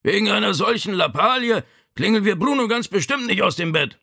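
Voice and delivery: high-pitched, indignant ailing father; strained old father refusing a fuss; Vito. A narrator's reading. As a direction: protest from the sickbed, pitched high.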